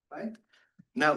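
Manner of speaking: normal talking voice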